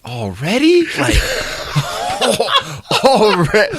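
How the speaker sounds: high, incredulous outburst breaking into laughter; laughing disbelief. Retold or imitated. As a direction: crack up as you protest in disbelief. Laughing retelling of disbelieving reaction.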